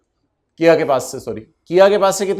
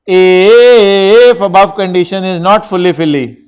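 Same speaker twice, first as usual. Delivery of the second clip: very slow, louder dictation, every word spaced out; 3 semitones higher, 9 dB louder, much slower than usual. Acted. Teacher dictating a rule to the class, loud and very slow. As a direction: call each word out slowly for the whole room.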